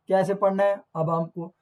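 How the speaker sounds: ordinary talk, nothing marked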